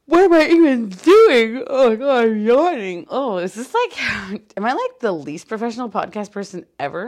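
half-laughing loud disbelief at herself; exasperated self-talk. Retold or imitated. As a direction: throw up your hands loudly at your own yawning. Exasperated self-quote about yawning.

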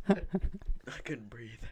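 breathless laughing, very slow. Real, not acted. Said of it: "Starting to cry right now".

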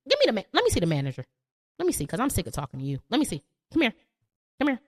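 rushed, fast demand for the manager; impatient customer. Retold or imitated. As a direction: spit it out like a fed-up customer. Acting an angry customer.

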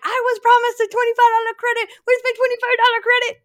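high, loud, strained outrage; indignant demand. Retold or imitated. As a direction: insist you were promised. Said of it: "I'm like".

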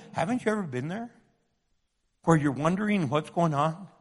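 ordinary delivery

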